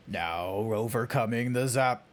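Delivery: low impression of a British voice actor; Tony J. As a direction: drop low to mimic the actor. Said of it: "now I know who Tony J is".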